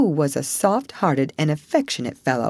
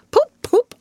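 much higher, louder honking poop poop; motor horn poop; 7 dB louder than usual. Acted. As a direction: toot like a car horn. Toad imitates motor horn.

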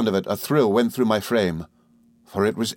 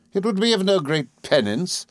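high, cold dismissal; Rosa Dartle, scornful; 10 semitones higher than usual. A narrator's reading. Rosa refusing pity, scornful. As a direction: answer the plea with contempt. Rosa coldly answering Emily's plea.

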